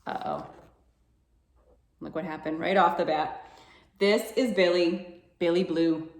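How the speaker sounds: ordinary delivery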